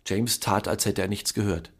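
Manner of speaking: usual speaking voice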